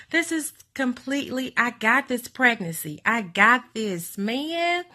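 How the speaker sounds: high giddy bravado; euphoric, pumped-up boast. Retold or imitated.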